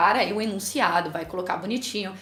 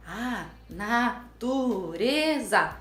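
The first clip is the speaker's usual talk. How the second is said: put-on child reading aloud; much slower than usual. Criança lê a palavra ditada.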